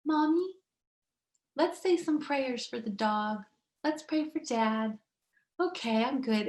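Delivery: put-on mild sleepy child after the fit; calm little girl. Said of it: "went from, like, rage and craze to".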